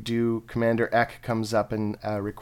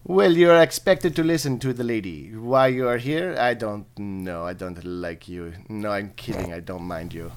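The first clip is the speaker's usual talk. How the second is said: badly faked French accent, slowing midway. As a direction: play a teasing elf commander in bad French. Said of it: "they're gonna be really bad French accents".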